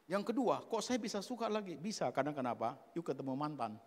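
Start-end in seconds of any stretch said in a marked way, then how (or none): none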